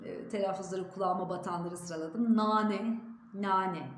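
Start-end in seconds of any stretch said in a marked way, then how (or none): 2.4-3.9 "nane" with a thin, fronted a; careful "nane", thin first vowel. Shown as a demonstration. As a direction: keep the a of "nane" light and front. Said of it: "ince olması gerekiyor".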